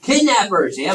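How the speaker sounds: ordinary talk, nothing marked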